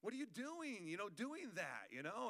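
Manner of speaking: high, slow exasperated scolding; mock bad cop. Dad lecturing hurt kid, high scolding. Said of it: "being like".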